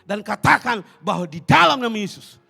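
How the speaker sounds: loud, high-pitched impassioned preaching; full-force preaching. Acted. Preacher rousing the congregation, loud and impassioned.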